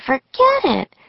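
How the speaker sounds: high-pitched brush-off; impatient dismissal. A narrator's reading. Annie overruling Jack's wish to read first, impatient dismissal.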